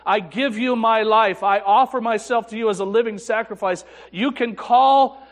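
loud, high, impassioned prayer of surrender; fervent vow. Retold or imitated. Preacher reliving his vow to God, loud and fervent. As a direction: pour it out like an earnest altar call.

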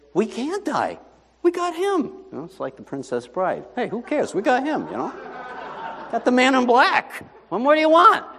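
high, a touch cocky; lightly voiced smug crew; cocky disciples. Disciples boasting, Princess Bride.

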